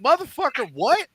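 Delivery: high, sudden mock outburst; imitating a movie tough guy's startled swear; Samuel L. Jackson outburst. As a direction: toss it off like Samuel L. Jackson's surprised cursing. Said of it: "took him exactly three secs to go".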